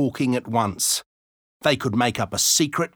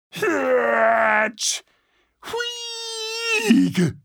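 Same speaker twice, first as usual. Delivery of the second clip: shrill squeals rising; high squealing cries. Acted. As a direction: squeal like a startled animal. Unseen animal noises in bushes.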